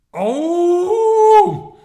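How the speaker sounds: high, loud dinosaur cry; anguished roar. A narrator's reading. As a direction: let out a desperate roar. Pushes T-rex away.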